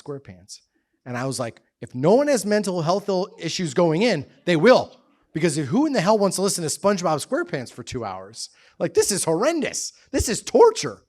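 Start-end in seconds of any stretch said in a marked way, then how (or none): 1.8-11.0 high-pitched exasperated rant; indignant, worked-up complaint; comic outrage. Retold or imitated. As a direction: protest as if being tortured, rising to the end. Mock outrage at SpongeBob in lockup.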